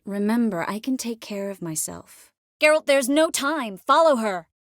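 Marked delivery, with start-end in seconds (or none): 2.6-4.5 loud, pressing command; urgent order. Acted. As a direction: push him to move at once, loudly.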